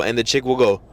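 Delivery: usual speaking voice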